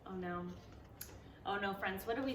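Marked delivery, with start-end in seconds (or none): none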